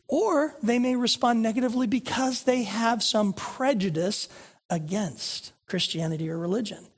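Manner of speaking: usual speaking voice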